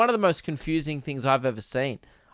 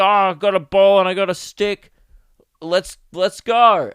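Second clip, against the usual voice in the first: put-on louder, eager voice of a kid with a ball and stick; excited old-time kid; 3 semitones higher, 7 dB louder than usual. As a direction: play the thrilled kid from the old days, loud. Voicing a WWII-era kid.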